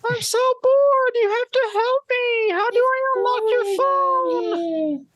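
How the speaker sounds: put-on high, loud whiny little girl; man doing a woman's voice. Continuing creepy girl voice.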